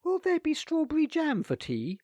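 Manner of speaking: high, sweet innocent question. A narrator's reading. Nicholas bargaining with his aunt, mock innocent. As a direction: ask as if butter wouldn't melt. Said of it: "asked Nicholas innocently".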